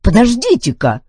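loud, high protest; exclaiming. A narrator's reading. Duncan interrupts the robot's threat, exclaiming. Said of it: "воскликнул Дункан".

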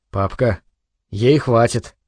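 low, soft reproof; lightly voiced boy sounding like a disapproving priest. A narrator's reading. Son asks father to stop pouring for mother, disapproving. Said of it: "говорил осуждающе, как священник".

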